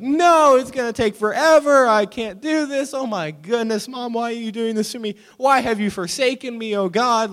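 very high, loud melodramatic wail; hammed-up kid in total meltdown; mock freaking out. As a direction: cry out in exaggerated despair. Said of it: "Like this, like I'm freaking out".

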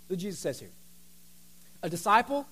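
usual speaking voice

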